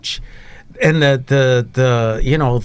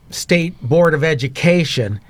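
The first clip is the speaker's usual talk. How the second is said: normal talking voice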